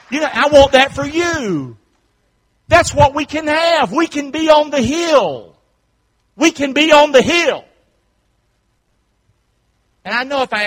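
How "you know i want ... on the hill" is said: high, loud, rousing pulpit voice; rally the congregation, loud and high; impassioned preacher's proclamation; full-force preaching. Real, not acted.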